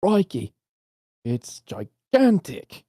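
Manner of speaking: lightly put-on Australian accent, slow on "It's gigantic"; a playful stab at an Aussie fisherman; mock Australian accent. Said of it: "Australian accent".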